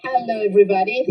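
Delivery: usual speaking voice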